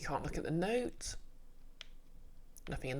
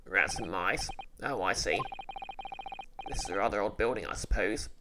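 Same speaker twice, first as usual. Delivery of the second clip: low voice, puzzled; a deep-voiced young man thinking aloud; puzzled male sidekick; 8 semitones lower than usual. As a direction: sidekick voice, pitched low and wondering. Male character voice.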